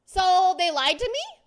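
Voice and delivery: loud, drawn-out indignant question; mock outrage. Streamer voices a friend learning of a lie, mock outraged. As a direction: raise the pitch and volume, slowly, in disbelief. Acted outrage in retold chat.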